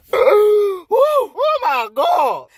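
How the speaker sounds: high, loud whoop of delight; ecstatic outburst. Acted. Eve tasting the apple, ecstatic whoop. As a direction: drag out a high-pitched rave.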